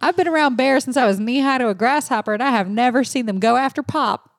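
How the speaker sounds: put-on Southern twang; Southern accent. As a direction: quote her as a folksy Southerner. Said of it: "I did a Southern accent".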